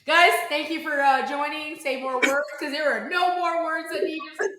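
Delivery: high, loud delighted praise; excited raving. Real, not acted. Host praising guest's comeback, high excited delight. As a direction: rave about the line.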